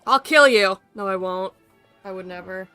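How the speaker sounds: ordinary talk, nothing marked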